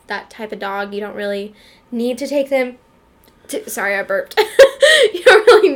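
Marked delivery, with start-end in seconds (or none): 4.4-5.8 laughing. Real, not acted. Laughing after burping.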